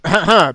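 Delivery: loud throat clearing cough. Acted. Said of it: "Toblin hustet".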